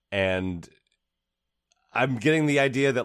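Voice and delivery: ordinary talk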